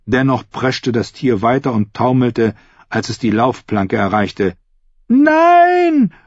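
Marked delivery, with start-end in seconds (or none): 5.1-6.1 lauter, sehr hoher, gedehnter Schrei; Schrei eines Mädchens. A narrator's reading. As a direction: schrei wie ein entsetztes Mädchen. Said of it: "rief ein Mädchen". (verzweifelter Mädchenschrei)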